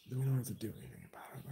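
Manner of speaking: fast conspiratorial whisper. Retold or imitated. Plotting not to tell the lieutenant, fast whisper. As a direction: whisper it fast like a guilty secret.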